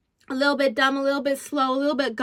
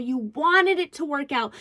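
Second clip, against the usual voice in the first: usual speaking voice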